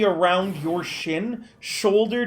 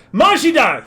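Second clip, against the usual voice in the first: higher, louder call across the room; 7 semitones higher, 6 dB louder than usual. Acted. NPC calling to Majida at the door, loud and high. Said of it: "he calls to the door".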